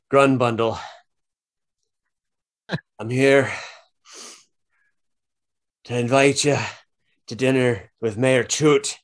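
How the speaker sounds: sheriff wheezing after hitting the door; winded lawman delivering a formal invitation; out-of-breath sheriff. Acted. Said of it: "He goes".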